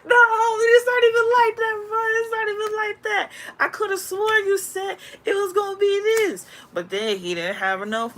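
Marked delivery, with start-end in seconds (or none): 0.0-6.5 a high, loud whiny voice pleading "No, homie"; whiny backpedaling. Acted. Guy backing out of a deal, high whiny pleading. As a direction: back out of the deal in a squeaky, desperate plea. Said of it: "they had to be like".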